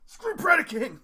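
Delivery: loud triumphant whoop; fist-pumping cheer. Retold or imitated. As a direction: yell it like a gloating fan. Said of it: "going like".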